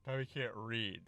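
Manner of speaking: talking through a yawn. Real, not acted. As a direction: let a real yawn swallow the words, softly. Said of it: "sorry, I was yawning".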